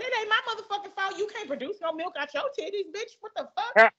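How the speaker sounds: high, scornful comeback; mock heated retort. Cashier mocking the customer, high and scornful. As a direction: throw the insult back at her. Retold insult in the quarrel.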